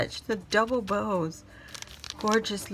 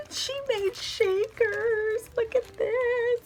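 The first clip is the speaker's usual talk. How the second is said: much higher, slow, thrilled; high delight; slower than usual. Real, not acted. Vlogger gushing about Lana's shakers, high thrilled voice. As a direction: gush over the handmade shakers.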